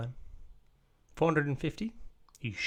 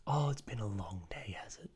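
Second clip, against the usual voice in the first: hushed, whispery echo; mock sympathy; 6 dB quieter than usual. Customer echoes the tired shopkeeper's complaint, mock sympathy. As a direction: whisper it back as if commiserating.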